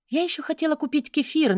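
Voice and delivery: ordinary delivery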